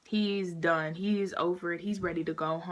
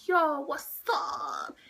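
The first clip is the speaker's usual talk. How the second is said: drawn-out, high-pitched greeting; super excited; 6 semitones higher, much slower than usual. Retold or imitated. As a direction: greet him excitedly, stretching it way out. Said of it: "He's super excited".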